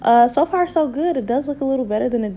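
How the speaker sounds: high, loud and pleased; bright burst of self-praise; excited. Real, not acted. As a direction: cheer it out, so far so good. Excited about the result.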